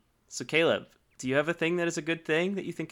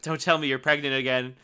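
ordinary talk

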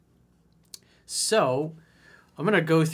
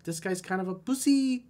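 higher, mock feminine Brazilian accent; 10 semitones higher than usual. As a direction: put on a teasing Brazilian woman's Brazilian accent. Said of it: "In my Brazilian feminine accent".

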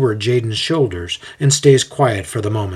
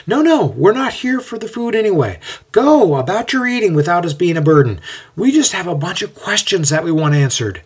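higher-pitched, lightly acted reassurance; insistent but easygoing, pitched up; brushing off protest; 8 semitones higher, 3 dB louder than usual. A narrator's reading. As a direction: wave away the host's worry. Aeneas waves off Jason's protest.

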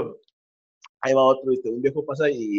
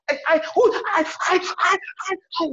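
hammed-up high yelps of an old woman; mock passionate high-pitched cries. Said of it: "se empieza a gritar la morra".

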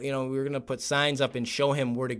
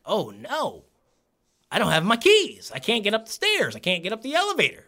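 higher-pitched mock distress; panicked old man; 6 semitones higher than usual. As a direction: play the helpless old man in a fluster. Acting out Rich stuck without keys.

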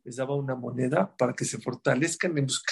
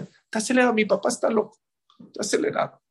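put-on child's voice; 7 semitones higher, faster than usual. Child describes frantic father.

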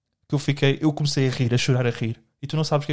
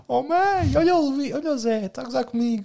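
put-on much higher whiny kid calling mum; mock whiny child; 11 semitones higher than usual. Mocking co-host who cried for his mum, whiny child voice. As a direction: whine for mum like a tattling child.

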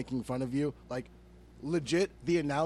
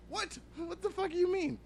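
incredulous squeak; 4 dB quieter than usual. Real, not acted. Disbelief at the player's logic.

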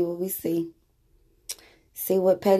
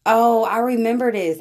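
excited recognition; 5 semitones higher, 6 dB louder than usual. Real, not acted. Recognizing an old clip.

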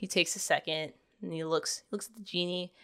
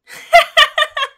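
high, loud burst of laughter; real delighted laugh; 16 dB louder than usual. Real, not acted. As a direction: crack up at the punchline. Laughs at the punchline.

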